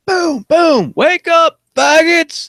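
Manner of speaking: loud, high bellow with banging sounds; guard's wake-up shout. Retold or imitated. Prison guard waking inmates on the wing, imitated loud shout. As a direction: bellow it like a guard rousing the cells. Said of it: "he's banging his stick two times on each door".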